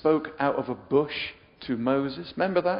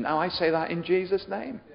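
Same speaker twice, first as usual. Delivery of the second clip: ordinary delivery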